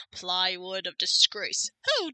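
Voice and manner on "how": put-on louder indignant British accent, "dare" punched; a pompous Brit scolding Phoenix; hammed-up British outrage. Edgeworth scolding Phoenix in a mock British accent. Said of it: "when did you get British?".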